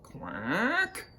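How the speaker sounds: "quack" soft, long and rising high; questioning quack. Shown as a demonstration. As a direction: ask "quack" as a drawn-out question. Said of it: "it's a questioning".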